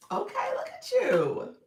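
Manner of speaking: high, loud delighted praise; excited praise. Real, not acted. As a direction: cheer them on with delight. Praising a viewer's progress.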